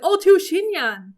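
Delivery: high, loud amazed exclamation; thrilled outburst; excited disbelief. Retold or imitated. Retells getting two Xinyan.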